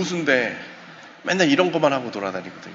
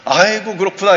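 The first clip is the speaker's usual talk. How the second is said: quick hollow "oh, I see" to end the talk; mock offhand reply, a bit louder; brush-off sympathy; 5 semitones higher, 6 dB louder than usual. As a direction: toss off the sympathy and turn away. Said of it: "이러고 도망가요".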